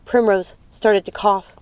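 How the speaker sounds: usual speaking voice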